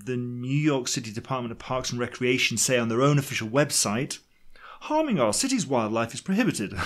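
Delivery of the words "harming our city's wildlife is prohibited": put-on plummy English officialese; plummy English accent. Parks department stating its rule on the website, in a plummy English accent. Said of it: "talking in a plummy English accent".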